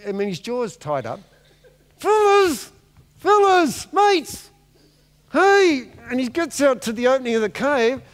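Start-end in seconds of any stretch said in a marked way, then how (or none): 2.0-5.9 high, loud calls after fleeing mates; dead man shouting after his mates; calling out. Acted. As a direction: yell to your friends down the road.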